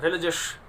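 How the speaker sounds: "रिलीजियस" as ri-li-jush; correct pronunciation. Shown as a demonstration. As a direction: reduce the end of "रिलीजियस" to a short jush. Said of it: "ओयूएस नहीं बोला जाएगा".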